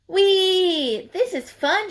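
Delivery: high gleeful shout with a laugh; excited whee. Acted. Porcupine skiing down slope, high excited squeal. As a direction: squeal with glee. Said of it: "shouted Penelope Porcupine".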